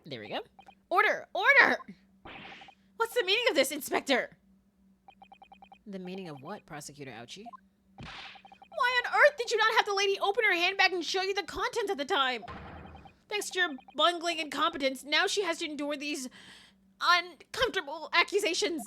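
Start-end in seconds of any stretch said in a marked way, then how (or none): 0.9-1.8 loud, rising shouts; judge calling order. Acted. Judge to a noisy court, loud call for order. 3.0-18.8 raised, outraged telling-off; indignant scolding. Acted. Prosecutor berating inspector over the handbag, indignant scolding.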